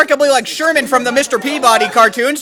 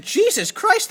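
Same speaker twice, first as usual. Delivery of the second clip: high surprised exclamation; 8 semitones higher, 3 dB quieter, slower than usual. Acted. Scarecrow exclaims.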